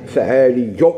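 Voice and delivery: فعالي with a held, doubled y before u; doubled final ya. Shown as a demonstration. Teacher showing students the word فعالي, y firmly doubled. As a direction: lean on the doubled y ending فعالي. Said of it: "اللي هي مشدودة هذه".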